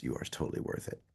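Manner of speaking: soft, breathy whisper of reassurance. Real, not acted. To viewers, affirming their worth in a whisper. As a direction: breathe it out like a secret.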